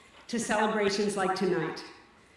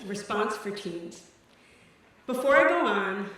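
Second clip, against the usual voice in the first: ordinary talk, nothing marked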